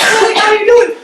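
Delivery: high, loud mock soccer girl; hammed-up intense athlete. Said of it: "then there's, like, soccer girl".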